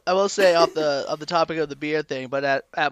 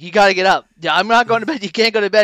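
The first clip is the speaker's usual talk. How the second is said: louder and higher, rousing; loud retold wake-up call; 4 semitones higher, 7 dB louder, faster than usual. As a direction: bark it to wake someone. Waking friend with a smack.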